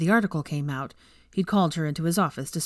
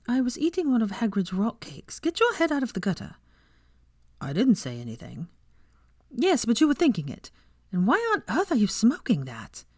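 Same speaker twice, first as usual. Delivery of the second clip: put-on a much higher young woman's voice, huffy; higher-voiced heroine, scolding; Hermione, indignant; 6 semitones higher than usual. A narrator's reading. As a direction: bristle at him with a high, prim retort. Hermione's voice in the story.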